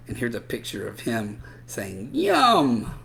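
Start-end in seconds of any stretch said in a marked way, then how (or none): 2.1-2.9 high, loud yum; delighted yum. Acted. Drawn Brian saying his lunch looked tasty, loud. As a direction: savour it out loud. Speech bubble in note.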